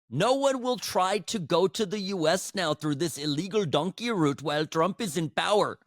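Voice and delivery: a hammed-up Indian accent; mock Indian accent. Host reads the deported man's quote in a mock Indian accent. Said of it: "sorry about that accent".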